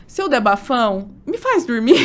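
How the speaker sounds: playful retold plea ending in laughter; joking plea. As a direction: laugh through the punchline. Joking plea to anesthetist friend, laughing.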